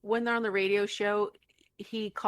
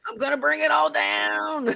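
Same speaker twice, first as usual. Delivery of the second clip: mad vow; 6 semitones higher, 5 dB louder than usual. Retold or imitated. Quoting Lefty sawing rafters.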